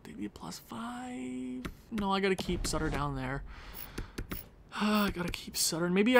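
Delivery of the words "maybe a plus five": very soft, slow, high whisper; whispered musing. Real, not acted. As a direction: whisper it slowly, weighing an option. Thinking aloud over lineup choice.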